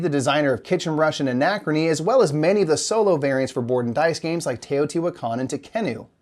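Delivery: normal talking voice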